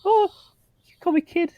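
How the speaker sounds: high gasp, then squeaky delight; thrilled awe. Acted. Character delighted by a new nickname, thrilled squeak. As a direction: gasp and marvel, pitch way up. Thrilled at being called kid.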